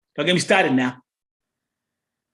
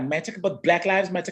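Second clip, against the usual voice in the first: ordinary talk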